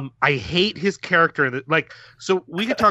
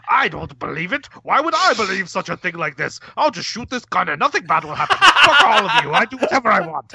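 mock stubborn cop; 4 semitones higher than usual. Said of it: "And he's like".

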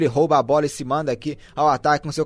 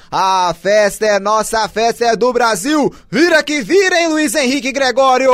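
sing-song, higher and joyful; celebrating; 5 semitones higher, 3 dB louder than usual. Real, not acted. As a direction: celebrate the lead. Celebrating the goal.

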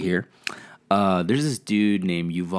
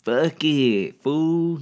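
put-on East LA accent; 9 semitones higher, 7 dB louder, much slower than usual. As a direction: toss it off like an East LA homeboy. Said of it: "whereas if you were just like".

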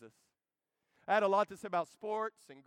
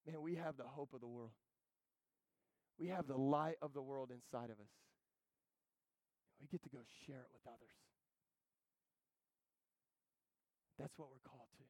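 much softer, lower, earnest; sincere hush; 4 semitones lower, 10 dB quieter than usual. Real, not acted. Preacher urging the congregation to share, soft and sincere. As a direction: draw them in gently.

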